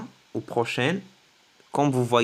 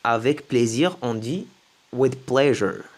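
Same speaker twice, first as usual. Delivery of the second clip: ordinary talk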